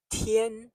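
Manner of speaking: Tian high and steady; first tone. Shown as a demonstration. Teacher's language note on Tian, high steady tone. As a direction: keep Tian high and level. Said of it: "It is first tone, high and steady".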